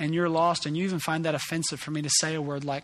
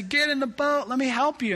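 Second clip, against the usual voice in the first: calling out; 9 semitones higher, 3 dB louder than usual. Pretend. Calling to someone from a boat.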